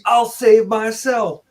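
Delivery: high-pitched hammed-up bravado; mock independent woman. Mocking the heroine who runs off from rescue, mock bravado. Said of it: "But yeah, the".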